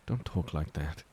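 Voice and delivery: a soft, hushed scolding; soft lament. Acted. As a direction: gently plead with him to stop. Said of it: "Henry softly laments".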